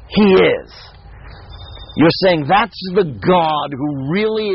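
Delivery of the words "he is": "He is" set apart, higher and louder; emphatic He is. Shown as a demonstration. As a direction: land "He is" as a firm statement. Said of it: "You're saying something about him".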